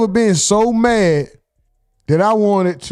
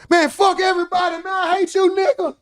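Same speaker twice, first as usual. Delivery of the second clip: high, fast mock rant shouted at the phone; mock furious yelling; 10 semitones higher than usual. Streamer yelling at his phone, furious mock rant. Imitating someone yelling on live.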